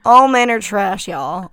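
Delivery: loud, hammed-up country drawl; country drawl impersonation. Joking impression of [name] for the co-host, hammed-up country drawl. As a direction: lean into a country twang. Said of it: "We're doing voice acting one oh one".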